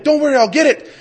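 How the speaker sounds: high, loud, eager reassurance; excited dad. Retold or imitated. Dad chasing the moon for his daughter, excited. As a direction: promise it eagerly, pitched right up. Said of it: "And I'm so excited".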